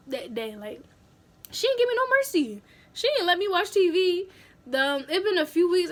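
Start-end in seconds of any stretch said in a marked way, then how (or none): none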